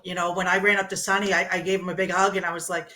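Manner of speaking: normal talking voice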